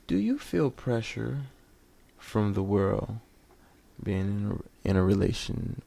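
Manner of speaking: slow, soft, measured professor voice; intellectual voice. Acted. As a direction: sound like a careful academic. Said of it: "Had to do my intellectual voice".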